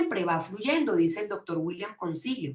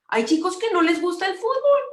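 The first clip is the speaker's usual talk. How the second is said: raised voice, much higher and louder; high, insistent exclamation; emphatic repeat; 11 semitones higher, 6 dB louder than usual. Real, not acted. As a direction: drive the point home loudly.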